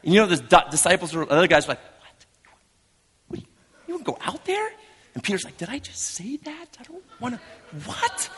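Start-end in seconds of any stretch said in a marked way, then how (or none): 2.0-4.7 hushed, high-pitched disbelief; incredulous. Acted. Stunned disciples question Peter. 5.6-8.3 soft, very high panicky squeak; mock nervous backpedalling in a small voice; Peter second-guessing. Peter panics at his own words.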